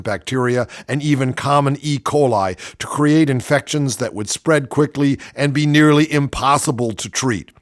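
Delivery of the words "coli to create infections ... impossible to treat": usual speaking voice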